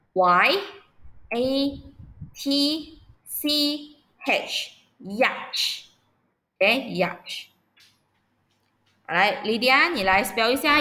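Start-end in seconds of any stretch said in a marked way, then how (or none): 0.1-7.5 very slow spelling. Shown as a demonstration. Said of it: "老师逐字母拼读yacht".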